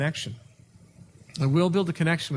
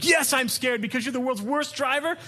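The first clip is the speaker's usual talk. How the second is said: high mock wife snapping back; high-pitched mock angry wife; 9 semitones higher than usual. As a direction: play the furious wife. Wife voiced in quarrel.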